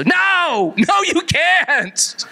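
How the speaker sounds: very high, drawn-out comic cry; mock outraged refusal. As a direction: cry out the refusal in exaggerated horror. Acted refusal of a request.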